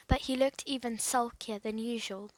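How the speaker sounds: usual speaking voice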